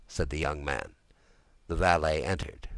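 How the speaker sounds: ordinary delivery, nothing marked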